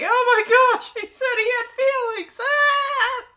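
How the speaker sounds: high-pitched mock hilarity; mocking ridicule. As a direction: imitate kids jeering at the biggest joke. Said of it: "just laughing like this is the biggest joke".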